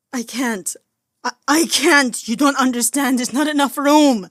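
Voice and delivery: high, loud sobbing protest; frantic tearful pleading; beyond hysterical. Acted. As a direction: cry out that they don't understand. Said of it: "He was beyond hysterical".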